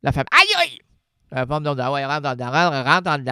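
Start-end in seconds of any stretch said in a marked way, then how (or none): 0.3-0.8 pained yelp. Acted. Acted pain cry in comedy bit.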